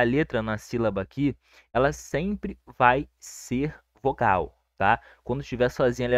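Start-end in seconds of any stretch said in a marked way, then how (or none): 1.7-4.6 spaced out word by word; slow right down to drive the rule home; deliberate, measured teacher emphasis; slow emphatic rule. Shown as a demonstration.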